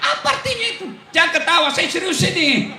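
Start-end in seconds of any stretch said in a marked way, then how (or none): 0.0-0.9 mock puzzled. Preacher joking with congregation.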